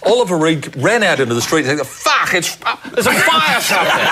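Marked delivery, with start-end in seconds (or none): 1.9-4.1 put-on high-pitched drunken panic; imitating a startled drunk raising the alarm; mock alarm. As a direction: blurt it out as if fleeing. Drunk actor fleeing a drenched building.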